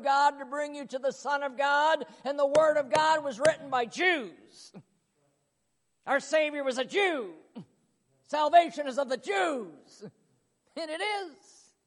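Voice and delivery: loud, high preaching push; preacher lifts the congregation; full preaching. Real, not acted. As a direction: drive the point home to the church.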